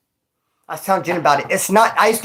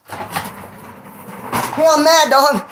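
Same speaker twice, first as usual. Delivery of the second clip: mock angry friend; 8 semitones higher, much slower than usual. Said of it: "He got offended".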